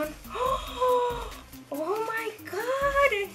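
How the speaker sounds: high excited squeals, shouting with delight; squeal of joy. Real, not acted. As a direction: shriek with happiness at the gift. Unboxing palettes, screams of joy. (grito de alegría muy agudo)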